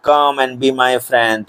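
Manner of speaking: drawn-out storybook read; lightly voiced duck, very slow; slow reading aloud. Shown as a demonstration. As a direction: read the duck's line slowly and clearly. Teacher reads duck's line in story.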